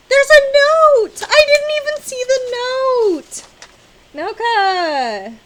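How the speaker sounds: very high, loud, drawn-out squeal; real excited shriek. Real, not acted. Finding an unexpected note from the gift's sender, excited shriek. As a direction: shriek at a wonderful surprise.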